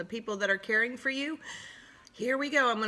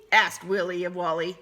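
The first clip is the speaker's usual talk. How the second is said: usual speaking voice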